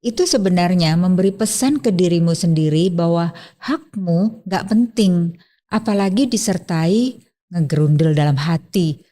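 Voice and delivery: ordinary delivery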